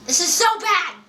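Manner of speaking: high-pitched exclamation of dismay; real, high dismay; exasperated outcry. Real, not acted. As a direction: cry out at how awful it is, pitch jumping up. Repeats complaint as an outcry.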